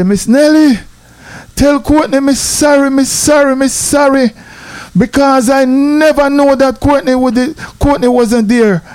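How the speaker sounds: high-pitched, pleading mock apology; apologizing. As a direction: imitate a man urgently saying sorry. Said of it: "he apologized to my grandmother".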